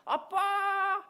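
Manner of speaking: put-on high, drawn-out child's call; calling out to dad. Boy calls his dad from the small slide, calling out. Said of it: "너무나 쉽게 올라가서".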